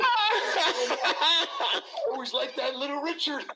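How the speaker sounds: dad coming round with a chuckle, drawn out; retold dad, laughing. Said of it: "He goes".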